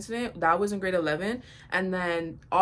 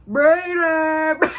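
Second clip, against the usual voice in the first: loud high call of a name; yelled name; 8 semitones higher, 9 dB louder than usual. Retold or imitated. The guy in the crowd yelling a name. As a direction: holler it from the back. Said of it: "just going".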